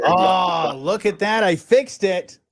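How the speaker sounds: high, loud celebration; triumphant surprise. Real, not acted. Host to listeners as the call connects, triumphant.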